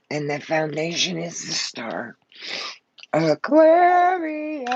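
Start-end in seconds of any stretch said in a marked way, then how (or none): 3.1-4.7 stretched out cry. Real, not acted. Overwhelmed reader gasps the sign.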